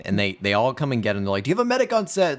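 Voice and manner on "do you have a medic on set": high-pitched imitation of a worried crew; mock urgent call. Urgent call acted in retelling.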